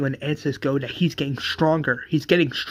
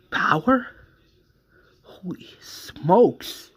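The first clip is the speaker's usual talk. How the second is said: amazed; 6 semitones higher, much slower than usual. Retold or imitated. Joe amazed at Barry's powers.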